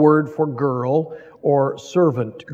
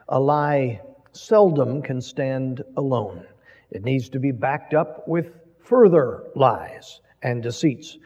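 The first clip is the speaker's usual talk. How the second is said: ordinary delivery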